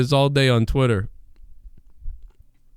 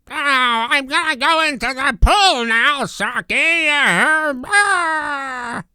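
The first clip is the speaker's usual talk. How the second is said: higher, goofy cartoon blob voice; blubbery alien voice; 8 semitones higher than usual. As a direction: ham up a cartoon blob villain. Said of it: "like the little blubbery one".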